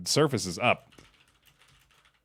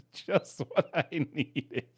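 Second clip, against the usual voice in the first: laughing; 3 semitones higher, slower than usual. Retold or imitated. Player repeats fox line laughing.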